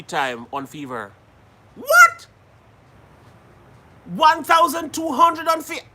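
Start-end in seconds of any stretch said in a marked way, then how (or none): 1.8-2.3 very high, loud shriek of disbelief; shocked outburst. Real, not acted. Host reacts to one thousand two hundred earned, shocked shriek. Shocked at reported online earnings. 4.1-5.8 high-pitched disbelief; incredulous repeat. Real, not acted. Host echoes the viewer's monthly earnings, incredulous.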